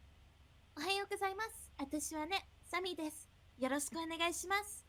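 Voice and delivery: high-pitched Japanese greeting voice; polite, bright-pitched self-introduction in a Japanese accent. Shown as a demonstration. Performer showing off a Japanese accent to friends, high polite greeting. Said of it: "That Japanese accent".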